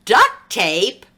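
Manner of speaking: plain reading voice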